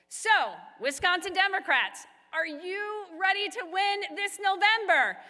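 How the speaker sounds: rally-stage shout building to a challenge; rallying call. Real, not acted. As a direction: fire up the crowd with the question. Rallying the crowd for a response.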